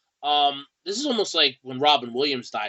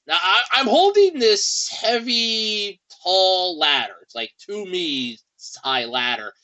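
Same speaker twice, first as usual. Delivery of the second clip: ordinary delivery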